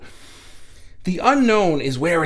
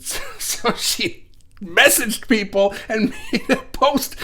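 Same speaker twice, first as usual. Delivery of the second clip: talking through real laughter, higher and slow; laughing; 6 semitones higher than usual. Real, not acted. Host telling viewers a funny story, laughing. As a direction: break up laughing while telling it.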